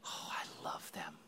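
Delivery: breathy whisper full of tenderness; whispered love. Acted. Jesus looking at the crowd, whispering his love. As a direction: whisper it as if to yourself. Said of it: "and he's saying".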